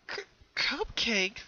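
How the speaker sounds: hesitantly. Acted. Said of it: "asked a little hesitantly".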